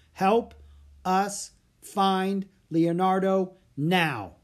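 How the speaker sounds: high, slow, loud wish, word by word; deliberate incantation. Acted. Annie making a five-word wish with the wand, deliberate incantation. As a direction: call out each word slowly and clearly.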